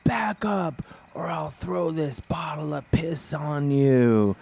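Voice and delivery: hammed-up threat, drawn out; mock homeless yell. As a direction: growl the threat out slowly like a wild man. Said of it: "And then he yells".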